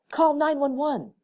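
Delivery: shouted. A narrator's reading. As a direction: shout for help across the room. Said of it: "Irene shouted".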